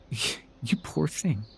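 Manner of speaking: slow, soft, syrupy false sympathy; mock pity. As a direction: drag it out like pitying a stray.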